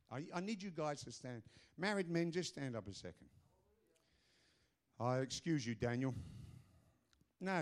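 ordinary talk, nothing marked

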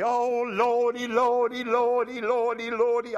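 high, loud, drawn-out Oh Lordy; loud, high, slow mock prayer wail. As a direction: mimic a man moaning his prayer through his teeth. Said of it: "I can even see the guy".

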